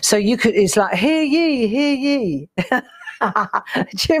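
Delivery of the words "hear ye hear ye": high, ringing mock town crier proclamation; town crier call.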